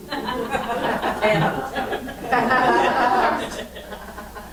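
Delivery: a long, real laugh that starts high and falls; preacher laughing at his own question to the congregation, real laugh. Real, not acted. Long falling laugh.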